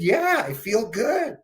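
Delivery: put-on high, slow dreamy old lady; dreamy mom. Stoned mom contented.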